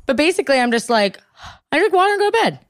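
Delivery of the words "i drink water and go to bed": carefree. Retold or imitated. Retold own breezy thought.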